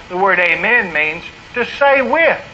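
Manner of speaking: usual speaking voice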